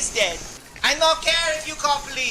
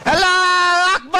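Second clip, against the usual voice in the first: yelled higher and louder; battle cry; 5 semitones higher, 7 dB louder than usual. Acted. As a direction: charge screaming. Charging the enemy house.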